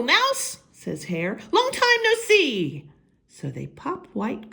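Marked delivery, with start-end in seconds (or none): 0.0-0.6 high, bright hare greeting. Acted. Hare greeting mouse, high and cheerful. 1.5-2.8 high, bright hare greeting. Acted. Hare greeting mouse, high and cheerful.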